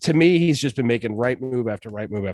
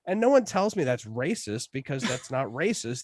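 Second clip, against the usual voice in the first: ordinary talk, nothing marked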